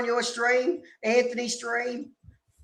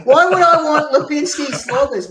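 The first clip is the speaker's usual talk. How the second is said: louder, laughing through the words; laughing disgust; 4 semitones higher, 8 dB louder than usual. Real, not acted. Mate disgusted by the flatmate suggestion, laughing loudly. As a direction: laugh out your disgust at the idea. Disgusted at secondhand [name] leftovers.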